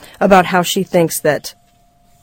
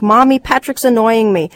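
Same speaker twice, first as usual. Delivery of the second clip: put-on higher-pitched child's whine to mommy; complaining little boy; 4 semitones higher, 3 dB louder than usual. Luke to his mother about his brother, complaining little boy. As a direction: tattle like an annoyed little kid.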